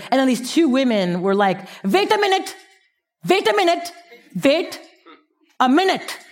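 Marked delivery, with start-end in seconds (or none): 1.8-6.2 put-on excited high voice with an Indian accent. Young Indian women hearing her pitch, excited Indian accent. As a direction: squeal it in an Indian accent, thrilled. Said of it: "And I'm Indian, I can do that".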